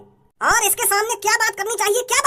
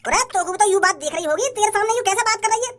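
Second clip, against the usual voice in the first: ordinary delivery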